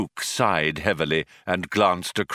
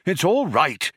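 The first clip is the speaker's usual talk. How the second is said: higher-pitched, exasperated correction; mildly angry reassurance; angry explaining; 8 semitones higher than usual. A narrator's reading. Older man corrects his colleague, mildly angry explaining. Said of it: "he explained angrily".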